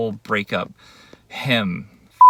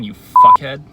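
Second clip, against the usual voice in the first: loud insult at the end; angry shout; 4 semitones higher, 14 dB louder, slower than usual. Real, not acted. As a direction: bark the insult. Telling boyfriend to stay away.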